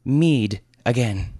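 high, loud, halting voice; hesitant youth. Acted. Flustered youth reporting the thief, hesitant. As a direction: stammer out the accusation. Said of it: "Pipkin hesitated".

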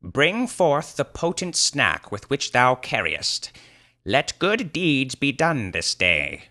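high, slow, ceremonial wizard; grand summons. Acted. Wizard bidding the elf produce a snack, grand. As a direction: proclaim it like a solemn rite. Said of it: "called Grand Alf".

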